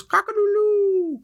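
imitated cockerel call; rooster crow. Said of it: "another cock goes".

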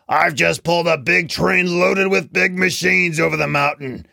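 bellowing freight engine. A narrator's reading. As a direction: bellow it out, full of self-importance. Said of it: "strong engine bellowed".

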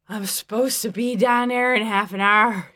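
put-on loud, slurred drunk protest; drunk husband. A narrator's reading. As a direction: slur it out like a drunk. Drunk husband slurring before sleep.

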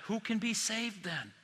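slow, soft worried murmur; hushed dismay. Retold or imitated. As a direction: ask it under your breath, dismayed. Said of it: "they said to each other".

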